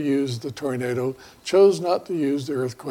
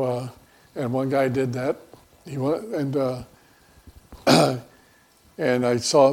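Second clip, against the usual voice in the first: ordinary talk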